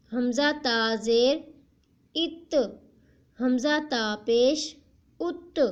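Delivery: put-on slow, deliberate spelling-out for children; slow syllable drill. Shown as a demonstration. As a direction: spell each sound out slowly for a young learner. Teacher drills Qaida syllables slowly.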